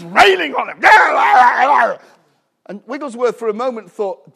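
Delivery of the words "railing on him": loud preaching shout. Real, not acted.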